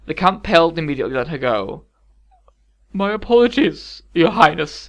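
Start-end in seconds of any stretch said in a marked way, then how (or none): 2.9-4.9 deliberate, raised-voice apology; tense, measured courtly apology; slow apology. A narrator's reading. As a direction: apologise carefully to royalty. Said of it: "he said slowly".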